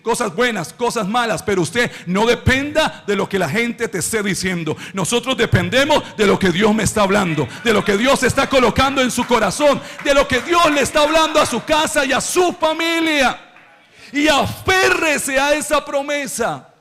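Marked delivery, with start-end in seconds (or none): 4.9-16.7 building to a high, shouted sermon climax; impassioned preaching. Real, not acted. Preacher urging listeners to hold to God's promise, impassioned.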